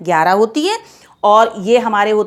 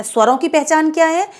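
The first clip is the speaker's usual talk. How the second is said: ordinary talk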